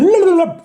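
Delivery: loud, very high tongue-flapping babble; nonsense babble. Pretend. Reader filling in a street name, high silly babble. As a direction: blurt a silly high gibberish noise.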